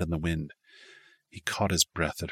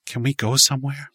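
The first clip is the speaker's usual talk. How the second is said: frantic; 8 semitones higher, 5 dB louder than usual. Acted. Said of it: "She looked around frantically".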